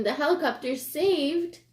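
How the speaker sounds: ordinary delivery, nothing marked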